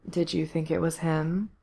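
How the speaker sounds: soft murmur. A narrator's reading. Villain needles his captive about the corpse, murmuring. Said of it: "Corse murmured".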